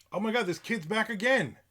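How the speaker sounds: high, loud disbelief; real exasperation. Real, not acted. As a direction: burst out in exasperated alarm. Spots the kid outside again.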